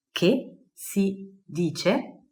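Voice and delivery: drawn-out Che si dice syllable by syllable; very slow model phrase. Shown as a demonstration. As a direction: stretch every syllable for learners.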